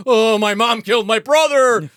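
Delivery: high, loud hammed-up lament; cartoonishly upset teenager; mock distraught wail. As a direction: wail the terrible news. Acting boy shuffling in with news.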